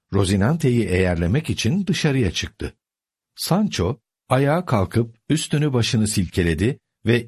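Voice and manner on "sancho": plain reading voice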